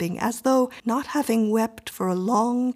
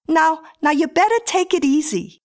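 raised, loud there-there; consoling neighbour; 9 semitones higher, 6 dB louder than usual. A narrator's reading. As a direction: settle her down, pitched high. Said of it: "consoled the woman".

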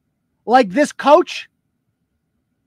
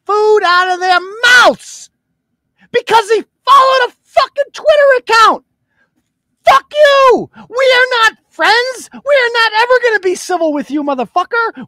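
much higher and louder, yelling in real fury; furious shout; 11 semitones higher, 5 dB louder than usual. Real, not acted. Host to the emailer, furious yelling. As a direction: scream it at him, all restraint gone. Furious rant peak at enemy.

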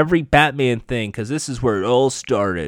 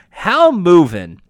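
high, slow, louder mock-British delivery; attempted British accent; 5 semitones higher, 6 dB louder, slower than usual. Recapper retrying Lady Vic's taunt, put-on British accent. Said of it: "Now I gotta try to a British accent".

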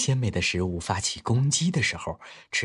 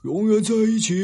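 high, loud group cheer; shouting out; 13 semitones higher, 9 dB louder than usual. A narrator's reading. The animals answer the fox's invitation together, shouting high and loud. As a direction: cry it out at the top of a high voice. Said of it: "他们大叫道".

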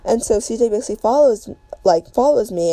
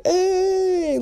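high, drawn-out teasing call; imitated teasing onlookers; 11 semitones higher than usual. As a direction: mimic friends hooting at a couple. Onlookers teasing the kissing couple.

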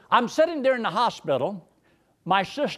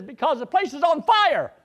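retold panicked warning; 9 semitones higher, 4 dB louder than usual. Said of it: "She says".

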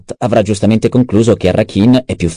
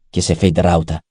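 plain reading voice

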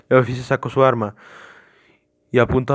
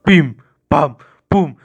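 loud, separate percussive bangs; imitated banging; 3 semitones higher, 7 dB louder, much slower than usual. A narrator's reading. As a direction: punch out each blow with a pause. Narrator imitates pounding on door.

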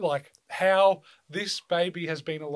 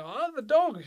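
high, louder burst of delight; delighted exclamation; 10 semitones higher, 5 dB louder than usual. Retold or imitated. Visitors walking in and seeing the puppy, delighted exclamation.

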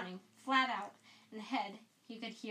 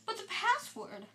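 shocked question; 10 semitones higher than usual. Acted. McGonagall shocked.

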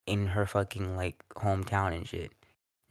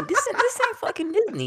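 laughing shout; 6 dB louder than usual. Real, not acted. Mocking repeat with laugh.

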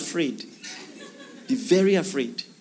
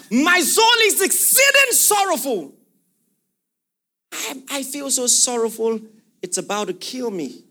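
anguished cry; 6 semitones higher than usual. Acted. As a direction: cry out the verse as grief overwhelms. Preacher voices Jesus' anguish.

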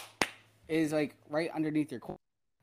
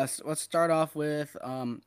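ordinary talk

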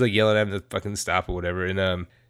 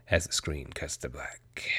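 whispered, much softer and lower; dramatic whisper; 8 semitones lower, 10 dB quieter than usual. Real, not acted. Telling friends how the movie ends, whispered. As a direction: let the ending hang in a hush.

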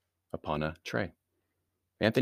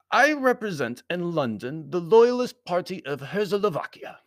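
high, louder Baron's voice; formal foreign Baron; 10 semitones higher, 5 dB louder than usual. A narrator's reading. Baron turning to business, high formal voice. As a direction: declare it formally, pitched well up.

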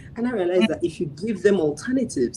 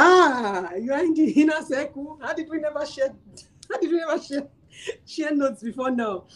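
much higher, amazed exclaiming; delighted surprise; 9 semitones higher than usual. Retold or imitated. Retelling her surprise at her sister being in the circle, higher amazed voice. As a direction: squeal it in happy disbelief.

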